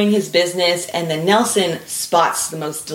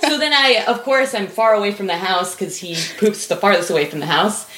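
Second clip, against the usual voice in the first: usual speaking voice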